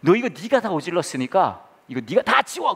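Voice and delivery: high-pitched scolding; angry dad. Retold or imitated. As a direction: lose patience and order the child. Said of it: "화를 내면서 얘기를 했죠". (화를 내며 아이를 야단치듯)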